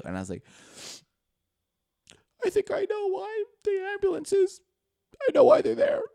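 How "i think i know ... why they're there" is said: high, loud wailing sob; mock crying. Boy confessing to mom, high loud sobbing wail. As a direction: blubber it out like a terrified kid. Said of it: "I just start crying".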